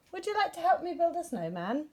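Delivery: high, eager bear voice; lightly voiced hopeful bear. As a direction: ask it hopefully.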